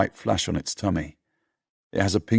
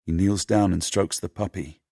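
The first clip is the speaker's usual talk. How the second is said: ordinary delivery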